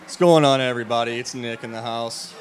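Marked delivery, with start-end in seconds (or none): 0.0-1.2 big loud emcee-style hello to the room; loud hype greeting. Real, not acted. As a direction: call out to the crowd like a party host. Hype greeting opening wedding toast.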